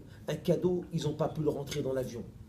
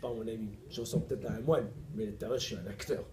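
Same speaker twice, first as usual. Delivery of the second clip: low playful reply; 6 semitones lower, 5 dB quieter than usual. Retold or imitated. Replying to the actress.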